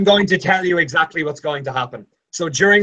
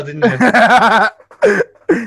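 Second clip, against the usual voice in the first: laughing through the words; 7 semitones higher, 7 dB louder than usual. Real, not acted. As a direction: laugh it out, caught out. Laughing while teasing.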